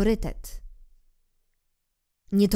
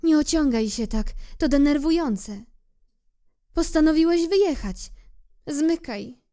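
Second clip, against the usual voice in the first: higher, louder, sending him off; proud rose; 10 semitones higher, 4 dB louder than usual. Acted. Rose urges little prince to leave, proud, higher. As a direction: push him away before she cries. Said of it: "Po chwili dodała".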